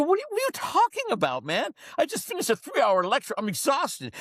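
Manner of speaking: high, baffled protest; retold exasperation. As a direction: protest in disbelief, pitch shooting up. Exasperated reply to screaming dentist.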